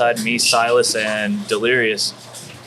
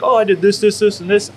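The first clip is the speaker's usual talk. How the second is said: mock eager listing; 10 semitones higher, 5 dB louder than usual. Rattling off imagined matches.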